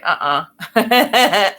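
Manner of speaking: playful "nope" from a doubting brain; brain's objection. Acted. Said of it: "your brain is like".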